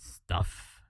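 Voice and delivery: low "Stuff" with a hint of a lisp on the s; slight lisp imitation. Streamer copying the reviewer's "Stuff", slightly lisped s. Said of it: "a little bit of a, s-, like a lisp".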